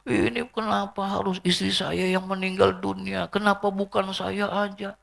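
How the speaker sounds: high, soft wailing plea; mock lament. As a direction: play a grieving husband protesting fate. Acted widower lamenting his wife's death. (meratap pura-pura, nada tinggi)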